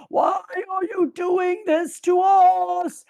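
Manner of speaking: high, slow screamed plea; retold scream. Retelling the king's plea, high slow mock scream. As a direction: wail it like a horrified king. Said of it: "the king is screaming".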